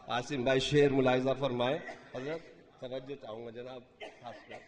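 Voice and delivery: normal talking voice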